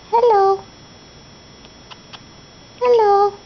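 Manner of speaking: teaching a parrot. Shown as a demonstration. Modelling word for parrot.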